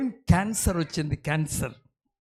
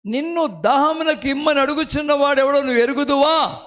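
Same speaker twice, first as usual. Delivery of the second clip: verse cried out higher and louder; proclaimed loudly; 8 semitones higher, 6 dB louder than usual. Shown as a demonstration. Preacher repeating the verse to the congregation, proclaimed loudly. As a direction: ring the verse out to the hall.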